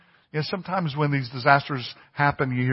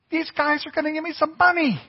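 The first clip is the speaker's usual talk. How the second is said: much higher, stretched, hopeful; eager beggar; 13 semitones higher than usual. Retold or imitated. Preacher voicing the beggar expecting money, high and eager. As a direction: let the hope lift the voice right up.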